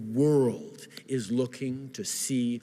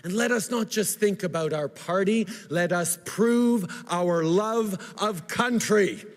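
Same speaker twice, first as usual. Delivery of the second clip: rousing speech; 8 semitones higher, 5 dB louder than usual. Real, not acted.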